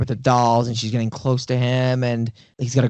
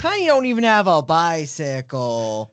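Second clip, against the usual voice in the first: movie character impression; 4 semitones higher than usual. Co-host quoting the movie's dinner-table line, mimicked exactly. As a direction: mimic her line, stretching out the last word. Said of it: "She does say it exactly like that".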